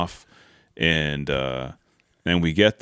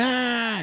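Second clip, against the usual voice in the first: mimicked scream. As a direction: imitate a herder's distant scream. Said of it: "you can hear him screaming".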